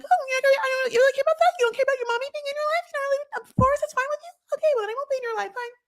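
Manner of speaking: very high, mocking mom whine; guilt-trip in a shrill mocking voice; mocking Molly. Mocking Molly guilt-tripping her son, high sarcastic whine. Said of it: "Molly's like".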